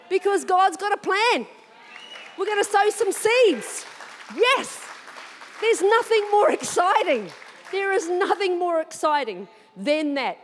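rising joyful preaching; excited. Real, not acted. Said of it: "why am I so excited?".

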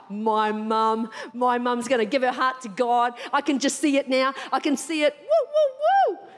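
rising excitement, ending in whooping; pumped. Acted. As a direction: hype yourself up into cheering. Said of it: "I am pumped".

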